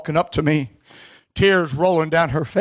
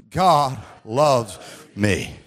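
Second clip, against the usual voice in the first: very slow, low, one word at a time; solemn call-and-repeat; 4 semitones lower, much slower than usual. Shown as a demonstration. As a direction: leave space after each word for the congregation. Leads congregation in repeating words.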